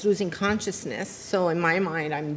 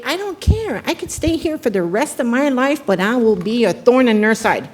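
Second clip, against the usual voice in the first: higher and louder, stubborn defiance; defiant retold outburst; 5 semitones higher, 7 dB louder than usual. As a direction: throw it back at them, daring. Retelling her defiant words in prison.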